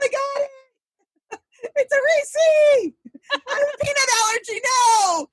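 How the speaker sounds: put-on very high, fast kid squeal with a laugh; squeaky kid voice. Child catching candy with a peanut allergy, very high squeal. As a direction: squeak it like a panicked little kid.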